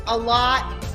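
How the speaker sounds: ordinary delivery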